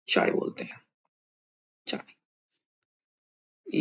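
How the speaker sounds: चाय whispered and drawn out very slowly; model pronunciation. Shown as a demonstration. Teacher whispers चाय slowly for learners. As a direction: breathe चाय long and soft. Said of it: "उसको चाय".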